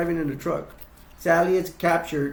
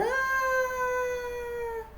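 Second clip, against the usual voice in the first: a thin terrified shriek; high scream; 5 dB quieter than usual. Retold or imitated. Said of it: "Sally's screaming".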